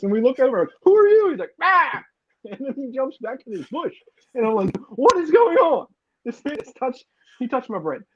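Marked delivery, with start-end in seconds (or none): 0.8-1.3 high, loud, alarmed question; shrieked in shock; startled shout. Retold or imitated. Stranger's hand in the bread. 1.6-2.0 high yelp of fright; short high scream of fright; startled scream. Retold or imitated. Homeless man startled. 4.9-5.9 high, loud, baffled question; squeaky high outburst of confusion; bewildered cry. Retold or imitated. Retold bewilderment.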